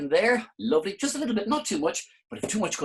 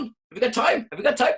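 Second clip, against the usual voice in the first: laughing at own pun; 9 semitones higher, 7 dB louder than usual. Real, not acted. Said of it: "these are great jokes".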